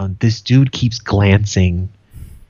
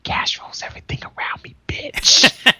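mock-menacing whisper; whisper up close. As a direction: whisper it close, with a final jab. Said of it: "get real close and go".

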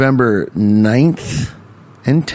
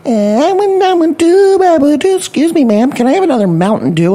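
very high, loud squealing brat; imitated demanding kid; 4 dB louder than usual. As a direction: screech the demand, then ease into a high polite request. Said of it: "Velvet's over there like".

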